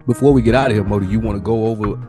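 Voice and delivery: ordinary talk, nothing marked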